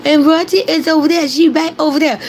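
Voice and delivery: not mean. Shown as a demonstration. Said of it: "he didn't say it mean".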